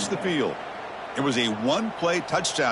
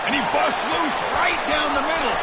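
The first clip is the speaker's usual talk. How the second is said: excited touchdown call; 9 semitones higher than usual. Acted.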